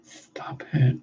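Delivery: soft, half-whispered plea; hushed protest. Pretend. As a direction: say it under the breath. First take of repeated protest.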